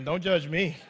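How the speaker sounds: ordinary delivery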